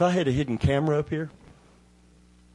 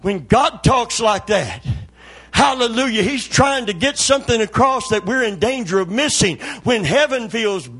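high, loud revival preaching, building in intensity; full-force preaching; 8 semitones higher, 8 dB louder than usual. Real, not acted. Preacher rousing the congregation about God's promise, full-force preaching. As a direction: preach it at the top of your range.

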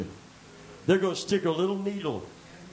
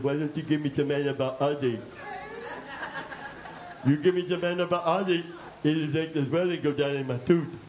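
talking through numb lips; numb mouth. Retold or imitated. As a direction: slur it like a numbed mouth. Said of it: "I said it this way".

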